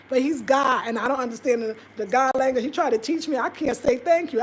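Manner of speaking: ordinary delivery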